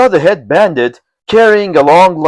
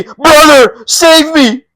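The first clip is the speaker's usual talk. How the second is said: high, louder desperate begging; pleading; 12 semitones higher, 4 dB louder, much slower than usual. Acted. Beaten lieutenant begging the chief, pleading. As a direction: cry out to be saved. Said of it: "he pleaded".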